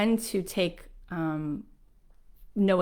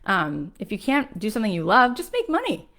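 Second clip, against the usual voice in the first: higher imitated voice urging practicality; advising friend; 3 semitones higher than usual. Friend advising her to work in real estate, higher and insistent. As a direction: mimic a pushy friend, pitched up.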